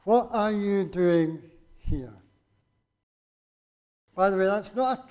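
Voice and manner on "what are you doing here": slow, each word weighed; slow pointed question. Shown as a demonstration. Preacher repeats God's question to Elijah, slow and weighted. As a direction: let the question land slowly. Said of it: "notice that God asks Elijah a question".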